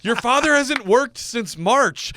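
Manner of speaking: high, slow, loud exasperated mom. Retold or imitated. Mom telling the kids about their jobless father, loud. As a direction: announce it to the kids in despair. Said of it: "And then the mom's like".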